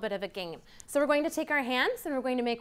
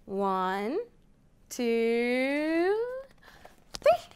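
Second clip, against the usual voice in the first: building countdown; much slower than usual. Acted. Teacher counting to children, building to an excited three.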